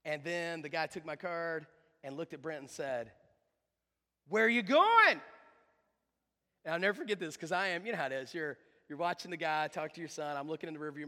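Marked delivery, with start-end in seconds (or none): 4.3-5.2 put-on loud, high voice for asking a child; hammed-up eager question. Starbucks guy to the boy in the car, hammed-up eager question. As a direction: imitate a barista booming brightly at a kid.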